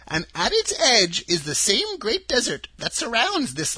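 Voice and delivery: put-on a sweet little old woman, high and gentle; old witch of the North. A narrator's reading. Witch of the North telling Dorothy her home, high and kindly. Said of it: "said the old lady".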